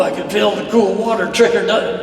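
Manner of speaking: plain reading voice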